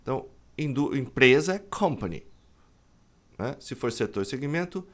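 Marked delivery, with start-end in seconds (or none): none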